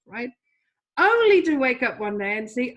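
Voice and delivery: normal talking voice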